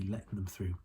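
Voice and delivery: ordinary delivery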